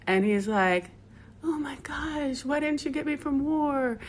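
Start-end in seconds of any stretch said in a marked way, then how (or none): 1.4-4.0 shocked whisper. Retold or imitated. Husband hears wife is dying.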